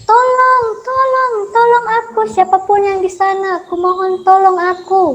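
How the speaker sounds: high, loud desperate cries; trapped lion cries out for help; calling for help. Acted. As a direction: shout for help from the trap. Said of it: "meminta tolong dan berteriak".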